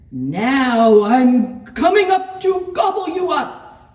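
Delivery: loud, high shouted threat; full-volume storybook menace; troll's roar. A narrator's reading. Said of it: "roared the troll".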